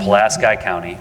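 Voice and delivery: Pulaski ending in "sky", stressed on the middle; Indiana local pronunciation. Shown as a demonstration. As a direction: repeat Pulaski as puh-LASS-kye. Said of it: "that's how they pronounce it in Indiana".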